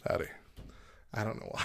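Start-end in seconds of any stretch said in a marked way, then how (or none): 0.1-0.3 understated, casual howdy; quiet, calm, nonchalant. Shown as a demonstration. Greeting a passing stranger, nonchalant and quiet. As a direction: toss it off calmly and quietly. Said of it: "very nonchalant, very quiet and calm".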